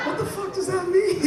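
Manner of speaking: high, loud disbelieving outburst; shocked what-does-that-mean; incredulous. Real, not acted. Comic reacts to the cashier's offer with high disbelief.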